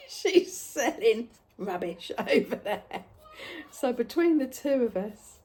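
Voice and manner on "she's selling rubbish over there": giggling through it, slowly; laughing tease. Retold or imitated. Jackie teasing customers toward Lisa's stall, laughing. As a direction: laugh as you send them off.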